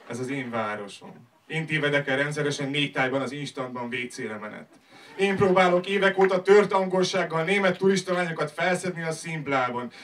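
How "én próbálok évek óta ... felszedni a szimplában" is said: raised, higher-pitched slam delivery; loud declaiming. Acted. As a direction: build to a loud, proud list of exploits. Slam poem builds to loud boasts. (hangosan, emelt hangon szavalva)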